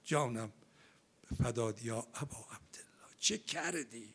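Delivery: soft, breathless whisper of devotion; devoted whisper. Real, not acted. Preacher whispering his devotion to Aba Abdillah, soft and breathy. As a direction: whisper it as if to the Imam himself.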